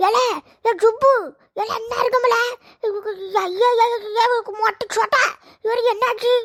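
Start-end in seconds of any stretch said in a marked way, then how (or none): 1.6-4.2 mock wail. Cry heard, panic.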